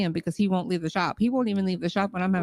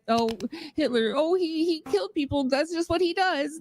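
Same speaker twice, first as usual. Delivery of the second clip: mocking quote; 9 semitones higher than usual. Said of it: "That's like saying that".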